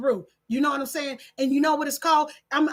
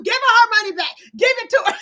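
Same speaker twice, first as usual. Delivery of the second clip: mock yell at screen; 5 semitones higher, 6 dB louder, much faster than usual. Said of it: "I was yelling at the screen".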